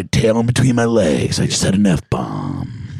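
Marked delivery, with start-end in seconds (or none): 1.4-2.9 deep mock-dramatic voice. Mock confession after swearing.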